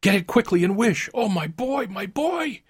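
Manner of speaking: very high, desperate urging; frantic urging climbing to a high anguished cry; frantic, panting plea. A narrator's reading. Wife begging for her dead son, high and frantic. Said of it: "she panted".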